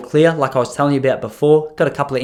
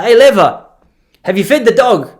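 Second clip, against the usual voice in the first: loud, raised-pitch mock old man; higher, louder impersonated grandpa; grandfather calling out; 9 semitones higher, 7 dB louder than usual. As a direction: holler it like grandpa summoning his wife. Voicing grandfather calling to wife.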